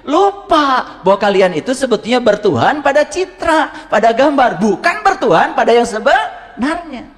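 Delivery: fervent sermon delivery; preaching. Acted. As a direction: drive home the word "lupa". Preaching on lalai.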